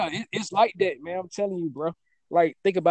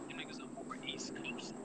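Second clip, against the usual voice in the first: breathy whispered aside, much softer; 18 dB quieter than usual. Real, not acted. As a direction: whisper it like a secret. Repeats his point in a whisper.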